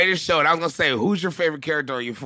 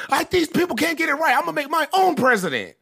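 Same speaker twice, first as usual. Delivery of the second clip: mock outraged rant; 13 semitones higher than usual. Retelling Ailes's angry rant.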